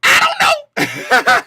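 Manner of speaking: squeaky high laughing shout; mock high squeal of the crystal ball; crystal ball answer. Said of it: "the crystal ball says".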